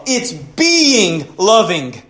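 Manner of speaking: "being" drawn out very slowly and leaned on; stress on being. Acted. Preacher stressing "being" over doing, very slow. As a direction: linger hard on "being".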